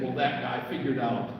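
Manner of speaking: ordinary talk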